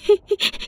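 high sobbing whimper; a small high sob before speaking; tearful whimper. A narrator's reading. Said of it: "vừa mếu máo vừa đáp". (tiếng nấc nghẹn ngào)

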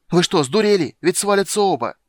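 high, loud outraged panic; frantic, indignant high shout. A narrator's reading. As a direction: yell at dawdling onlookers. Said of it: "кричал Лютик".

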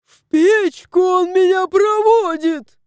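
high, loud outrage; indignant. A narrator's reading. Cat protests the doctor's plan, indignant. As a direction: cry it out indignantly. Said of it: "возмутился Варфоломей".

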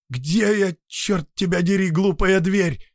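high, loud angry yell; angry shout. Acted. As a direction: yell at the stupid door. Cursing the stupid door.

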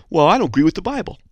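high-pitched defiant objector; hammed-up willful refusal; mock arrogance. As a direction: dismiss it stubbornly, climbing to a high finish. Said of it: "Arrogance is when you say".

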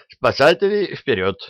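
high-pitched opening, playful call; blurted rallying cry. A narrator's reading. Doctor joining the rescue cheer, blurted call. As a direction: blurt it out like a catchphrase. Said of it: "брякнул доктор".

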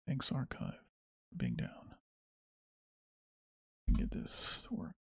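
breathy muttering under the breath; muttered annoyance. Real, not acted. Grumbling to himself at the archive site being down, muttered. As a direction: grumble it to yourself, barely voiced.

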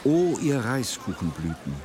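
usual speaking voice

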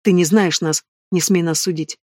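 ordinary delivery

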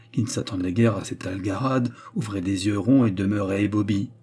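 usual speaking voice

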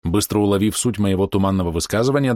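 ordinary delivery, nothing marked

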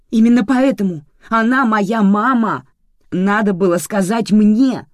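loud, high-pitched outburst; angry shouting. A narrator's reading. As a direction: shout it at him in full quarrel. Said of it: "продолжала кричать Даша".

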